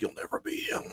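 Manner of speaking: breathy whispered warning; whispered threat. Retold or imitated. Miss Minutes shutting down, whispering a last threat. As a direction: hiss it under the breath.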